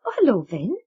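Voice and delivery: cheerful bright hello; bright greeting. Acted. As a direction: greet him brightly, unaware. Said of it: "said brightly".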